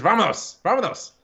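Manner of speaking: "Vámonos" pitched high on the first syllable, then falling; lively Vámonos. Shown as a demonstration. Man showing how his phrase must be said, "Vámonos" high then falling. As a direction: punch the start of "Vámonos" high. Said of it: "you gotta say it in that way".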